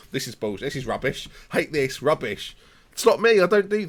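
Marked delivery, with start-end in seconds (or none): 0.1-2.5 low, quiet grumbling complaint; mock Twitter moaner. Streamer parodying Twitter complainers in a low quiet grumble. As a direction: mutter like a sulky online critic. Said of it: "I don't write on Twitter".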